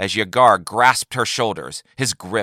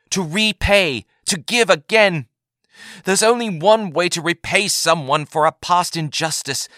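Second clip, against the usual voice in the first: earnest Yagar; 8 semitones higher than usual. A narrator's reading. Yagar explains forgiveness to Taith, higher and earnest. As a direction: plead for her to understand.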